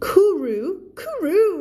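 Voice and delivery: high, loud bird call; bright pigeon coo. A narrator's reading. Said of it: "it said brightly".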